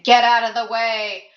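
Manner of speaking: loud warning yell. A narrator's reading. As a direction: shout it across the street. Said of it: "Lawrence yelled".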